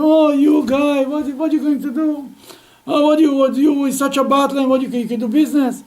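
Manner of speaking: loud, jeering gamblers' taunt; mocking card players. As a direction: sneer at him loudly, high and scornful.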